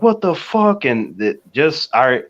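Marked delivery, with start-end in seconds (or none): 0.0-0.8 high-pitched imitation of alarmed disbelief; scared parents. Parents reacting to the movie outing, mock alarm. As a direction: mimic a parent's startled outburst, pitched up. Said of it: "how scared they were".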